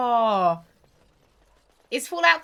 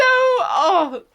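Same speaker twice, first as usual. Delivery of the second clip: surprised; 10 semitones higher, 11 dB louder than usual. Real, not acted. Shouted oh.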